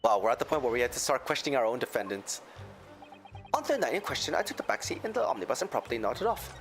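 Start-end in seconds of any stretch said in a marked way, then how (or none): 3.5-6.5 a lilting Irish brogue; Irish accent. Acted. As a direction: tell it like an Irish witness. McGilded testimony repeated.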